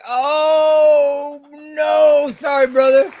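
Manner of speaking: high, loud and slow cry of dismay; shocked outcry. Real, not acted. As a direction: yell it like the wheel betrayed you. Shocked reaction to elimination.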